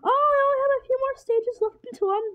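high-pitched, excited squeal; excited realization. Real, not acted. Player with few stages left, excited realization. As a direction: let the voice soar as the end nears. Player nearing the end exclaims.

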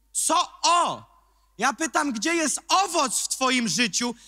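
high, loud challenge; loud preaching. Acted. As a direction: call them out loudly. Preacher mocks then shouts. (głośne, wysokie wyzwanie)

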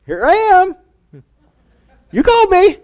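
put-on very high, comic child voice; Samuel. Boy back at the priest a second time, very high child voice. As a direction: squeak it out like a small boy. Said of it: "this little kid".

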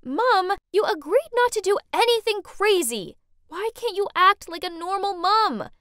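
high, loud exasperated protest; indignant teen-style outburst; arguing daughter. A narrator's reading. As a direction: complain sharply to mom, pitch up. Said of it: "we started arguing".

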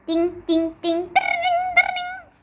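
high ringing ding sounds; oven bell imitation. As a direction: ring like a toy oven timer. (fırın zili taklidi)

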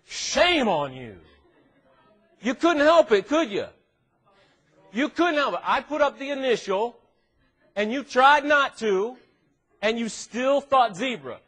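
loud, high, drawn-out mock outrage; mock scolding. Preacher shames the audience for thinking of zebra, mock scolding. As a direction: scold them in pretend outrage, really loud.

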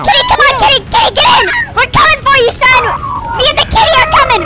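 high, loud rallying shout; excited hollering. Pretend. Dad and kitty racing to son, excited shouting. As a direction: holler like the rescue is on its way. Calling cat, rushing to son.

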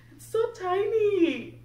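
high, slow coo; high drawn-out awe. Real, not acted. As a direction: melt over how tiny it is. Cooing over tiny diaper.

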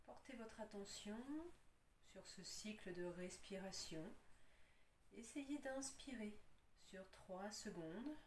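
calm, unhurried yoga nidra guidance with long pauses; guided relaxation. Acted. Yoga teacher settles students lying on their backs, guided relaxation. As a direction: ease them into rest, leaving space between instructions.

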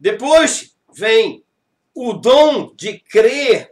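each word drawn out slowly; slow it right down for the point; deliberate slow sermon emphasis. Acted.

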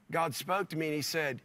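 usual speaking voice

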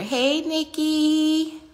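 high, long-held hello; loud singsong greeting, very drawn out; excited greeting. Real, not acted. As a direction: call out to a friend, stretching the name. Greets a viewer joining the live.

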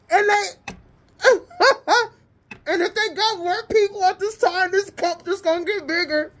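high, squealing laughter through the words; real helpless, gleeful cackling; laughing hard. Real, not acted. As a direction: crack up while trying to talk. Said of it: "let me stop laughing".

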